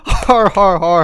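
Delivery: loud pirate har-har; pirate laugh. Acted. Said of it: "my pirate lingo".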